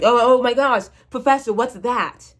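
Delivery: hammed-up gasp of discovery; mock excited alien. Alien archaeologist finds her bones, hammed-up excitement. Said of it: "they're gonna get to me, like".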